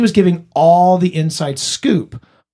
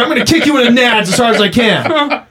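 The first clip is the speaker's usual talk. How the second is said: put-on angry drunk; 6 semitones higher than usual. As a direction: shout it like a belligerent drunk. Drunk friend turning aggressive.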